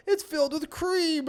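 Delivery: high, drawn-out mock amazement; amazed announcement. Son discovering cream in the donut, hammed-up amazement.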